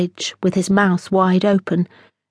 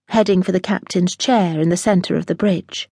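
usual speaking voice

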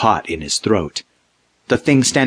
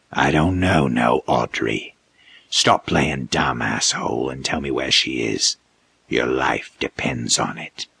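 low, slow snarling threat; menacing creature; 8 semitones lower, 4 dB quieter than usual. A narrator's reading. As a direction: lean in with menace.

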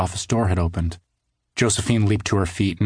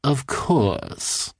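lightly voiced odd man, courteous; a hint of a smug stranger bowing; polite bow; 6 semitones higher than usual. A narrator's reading. Odd man bowing to the cartoonist, lightly courteous. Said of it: "the man said with a bow".

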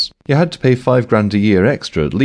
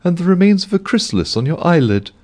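higher voiced bank clerk, subdued; Mavis voice; 12 semitones higher than usual. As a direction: play the tired Mavis, much higher.

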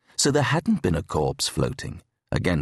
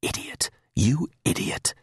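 whispered, scornful idiot; whispered self-reproach echoing in the helmet; 3 semitones higher than usual. Acted. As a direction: hiss it at yourself in a whisper. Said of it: "it sounded like".

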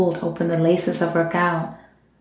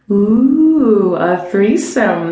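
teasing; 3 semitones higher, 6 dB louder, much slower than usual. A narrator's reading. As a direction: tease her friend playfully. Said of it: "Maxine teased".

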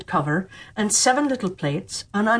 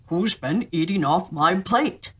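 slow, lightly voiced different dwarf; a slow, puzzled little dwarf; second dwarf; 2 semitones lower, slower than usual. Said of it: "And the second".

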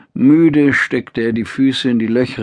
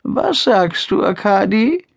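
put-on frail old mother; 9 semitones higher than usual. Old mother asks her son.

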